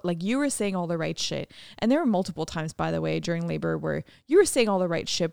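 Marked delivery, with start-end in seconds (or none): none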